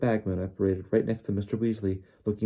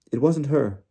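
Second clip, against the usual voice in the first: higher, louder protest; raised, urgent defence; Hermione nervous yet determined; 6 semitones higher, 6 dB louder than usual. A narrator's reading. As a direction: speak up despite nerves. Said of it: "She looked very nervous".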